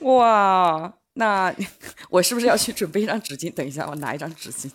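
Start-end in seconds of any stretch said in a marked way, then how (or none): 0.0-3.5 loud and high, playfully alarmed; fast, mock alarm over tissues; excited teasing. Real, not acted. Host reacts to tears mention.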